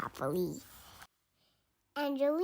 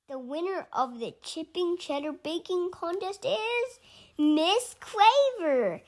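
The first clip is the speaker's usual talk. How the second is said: announcing; 6 semitones higher than usual. A narrator's reading. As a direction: announce the winner with suspense, then a big reveal. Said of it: "a judge announced".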